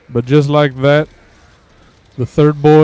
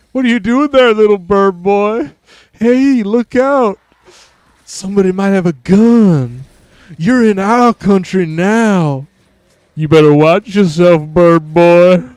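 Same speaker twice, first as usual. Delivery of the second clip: higher, louder, slow taunting voice, cheeps very high; mocking character voice; 6 semitones higher, 4 dB louder than usual. Taunting character mocks the bird boy, high and loud. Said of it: "This is kind of a fucked up character".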